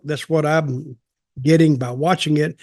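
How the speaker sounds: ordinary delivery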